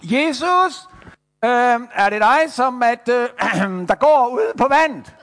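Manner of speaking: hesitant shout across the water; calling out. Acted. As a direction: call out unsure who is there. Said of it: "så han råber lige".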